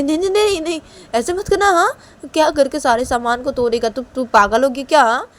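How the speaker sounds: high, loud, repeated protests; alarmed mother. Acted. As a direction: stop the son in a loud, high panic. मां घबराकर रोकती है. (घबराकर ज़ोर से रोकती माँ)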